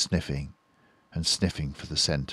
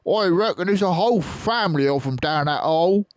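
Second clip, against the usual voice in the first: fast, high, loud farmer voice; eager gloating Bunce; 12 semitones higher, 7 dB louder, faster than usual. Acted. Bunce guessing a fox family, fast and gloating. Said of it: "Bunce said".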